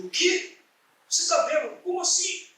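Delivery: espanto encenado, voz bem aguda; incrédulo. Retold or imitated. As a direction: repita a acusação como pergunta aguda e espantada. Incrédulo com o que o amigo diz. (incrédulo, com voz aguda)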